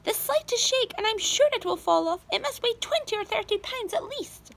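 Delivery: high, loud nagging aunt; shrill Aunt Spiker. A narrator's reading. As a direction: warn him off in a high pitch. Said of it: "Aunt Spiker yelled".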